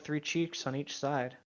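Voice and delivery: ordinary talk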